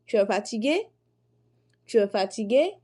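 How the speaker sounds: "Tu es fatigué?" with the voice rising on "fatigué"; questioning tone. Shown as a demonstration. Teacher showing students a rise on "fatigué" in "Tu es fatigué?". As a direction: lift "fatigué" into a clear question. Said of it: "le ton d'interrogation".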